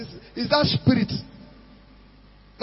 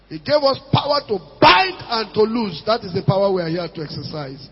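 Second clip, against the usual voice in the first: fiery preaching; 3 semitones higher, 6 dB louder than usual. Real, not acted. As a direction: preach it with full force. Preaching at full force.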